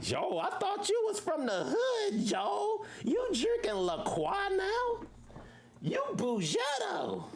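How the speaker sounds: high, teasing voice of a friend calling him out; mock friend teasing.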